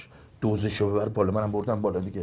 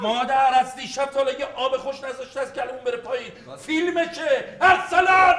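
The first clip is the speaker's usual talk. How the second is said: loud frantic calling out; yelling; 7 dB louder than usual. Acted. Mother calls for her missing son, loud and frantic. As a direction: shout the name across the house.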